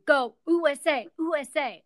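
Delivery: imitated crowd cheer. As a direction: cheer it like supporters abroad, lightly hammed-up. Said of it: "people in foreign countries screaming for you".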